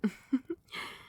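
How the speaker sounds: short, light giggle; fond chuckle. Acted. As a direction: chuckle affectionately at your darling. Amused, fond queen.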